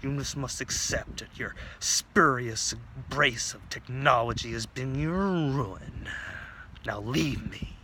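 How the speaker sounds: slow, drawn-out master's pronouncement; stern martial arts master. Acted. Martial arts master dismissing his pupil.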